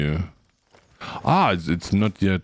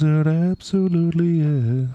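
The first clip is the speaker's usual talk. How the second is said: gushing echo; 10 semitones higher than usual. Real, not acted. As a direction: repeat it, still thrilled. Answering own question enthusiastically.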